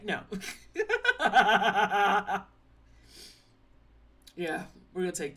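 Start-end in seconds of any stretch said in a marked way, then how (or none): 0.7-2.4 loud burst of laughter; long, rapid belly laugh. Real, not acted. Streamer laughing at pets checking in, loud laughter.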